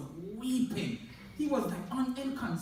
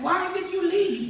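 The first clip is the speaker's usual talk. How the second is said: higher, louder, slow wailing plea; mock wailing; 8 semitones higher, 6 dB louder than usual. Man at the grave wails to the dead ex-husband, hammed-up. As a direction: ham up a weeping man's cry.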